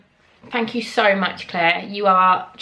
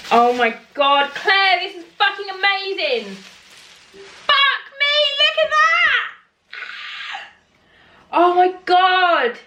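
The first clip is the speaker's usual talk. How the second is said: very high, loud thrilled shriek; delighted scream; 10 semitones higher, 3 dB louder, slower than usual. Real, not acted. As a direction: scream it with pure joy.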